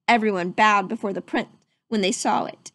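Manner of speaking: ordinary delivery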